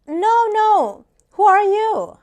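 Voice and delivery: loud, high-pitched imitation of a wary caller; mock-suspicious woman on the phone; retold woman caller. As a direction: mimic the caller's insistent challenge, high and loud. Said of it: "she was like".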